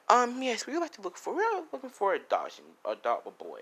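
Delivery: high, put-on polite parent voice; a prim couple asking sweetly for a boy; polite adopting parent.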